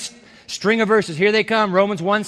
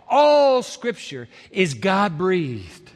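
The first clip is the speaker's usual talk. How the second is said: all scripture is God-breathed, drawn out very slowly; slow, savored; much slower than usual. Shown as a demonstration. Preacher lingers on the verse for his listeners, slow and savoring. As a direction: let each word sink in slowly. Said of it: "savor that thought for a moment".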